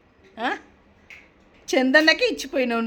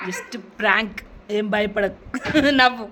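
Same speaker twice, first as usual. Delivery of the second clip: normal talking voice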